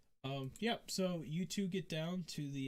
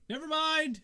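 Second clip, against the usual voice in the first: high, louder sheepish retreat; nervous guard dropping it; flustered guard; 12 semitones higher, 7 dB louder than usual. Acted. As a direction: back off quickly, embarrassed. Embarrassed guard backs off.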